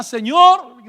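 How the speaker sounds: sudden loud cry of Lord; retold desperate shout; crying out to God. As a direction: call out Señor in desperation. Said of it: "yo le gritaba a Dios".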